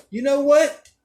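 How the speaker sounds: a high, loud, playful fuck you; mock friendly. Playfully cursing at the girl who never asked for his number. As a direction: tease her in a friendly way, pitched high. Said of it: "I said, in a friend".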